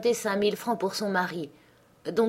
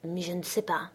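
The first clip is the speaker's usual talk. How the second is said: murmured; 8 dB quieter than usual. A narrator's reading. Cousin, cornered by questions, murmuring softly. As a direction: let it fall to a murmur. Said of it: "puis murmura".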